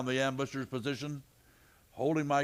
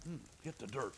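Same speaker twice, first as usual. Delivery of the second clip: urgent hushed order; 12 dB quieter, faster than usual. Acted. Matt to Clancy under fire, hushed urgent order. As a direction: keep it down, they're shooting. Ducking from gunfire.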